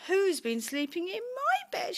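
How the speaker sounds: high, loud little bear voice; story-time indignant baby bear. A narrator's reading. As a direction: cry out the question as a small cub. Baby Bear finds bed used.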